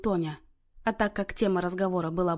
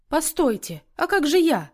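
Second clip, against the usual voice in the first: high, raised-voice protest; crying out; 7 semitones higher, 4 dB louder than usual. A narrator's reading. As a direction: call out anxiously not to be left out. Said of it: "вскричала Сонечка".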